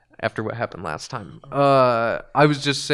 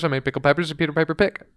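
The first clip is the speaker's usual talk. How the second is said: fast tongue twister; 2 semitones higher, 4 dB louder, much faster than usual. Shown as a demonstration.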